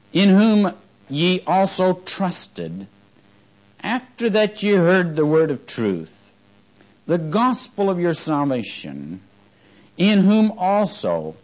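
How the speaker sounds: slow, high, drawn-out reading of Scripture. Acted. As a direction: read the verse slowly, lifting it up.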